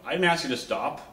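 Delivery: loud, high, scolding shout; police officer shouting at wife for stopping on the road, loud and high; officer yelling. Retold or imitated. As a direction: yell it at the driver like an angry cop. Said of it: "the police officer is yelling at her".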